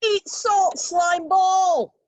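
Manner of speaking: high, loud shrieked battle cry. Acted. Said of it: "Her soon-to-be ex-roommate shrieked".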